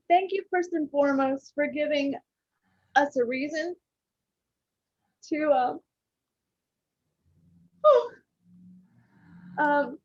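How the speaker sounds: real choked-up gratitude, halting; overcome, pausing and sighing between phrases; getting emotional. Real, not acted. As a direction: give thanks while fighting to keep composure. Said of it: "I'm gonna get emotional".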